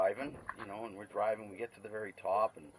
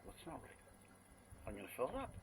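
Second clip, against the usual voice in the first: confused hushed reply; 7 semitones higher, 9 dB quieter than usual. Retold or imitated. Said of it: "I'm like".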